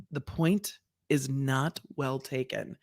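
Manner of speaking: "not well taken" low, soft and very slow; dry, measured shade from the bench; judge sass. Retold or imitated. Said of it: "that is Judge Sass".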